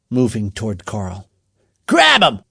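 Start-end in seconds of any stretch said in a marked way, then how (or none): 1.9-2.4 high, loud urgent yell; urgent shouted command; called order. A narrator's reading. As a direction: yell for the gang to seize him. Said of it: "called Carl".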